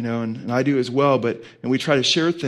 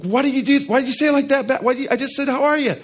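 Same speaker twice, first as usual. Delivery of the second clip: high-pitched mock indignant retort; snapping back; 10 semitones higher, faster than usual. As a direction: act out a defensive husband snapping back at his wife. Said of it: "I could snap back".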